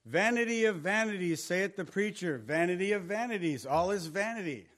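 reads the verse out loud and grand; scripture reading. Shown as a demonstration. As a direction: proclaim the verse with weight. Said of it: "look at this".

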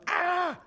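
high-pitched exasperated yelp; mock scream of overwhelm; frustrated cry. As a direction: let out a stressed-out "ah". Acts out stressed Martha in kitchen.

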